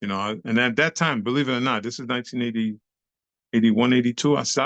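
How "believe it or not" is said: normal talking voice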